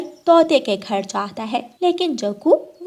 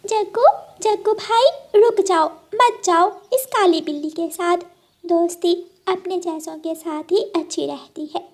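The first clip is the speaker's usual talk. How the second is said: higher, urgent little bird; pleading bird friend; 8 semitones higher than usual. Acted. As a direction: call out a warning to a friend. Bird friend warns the crow.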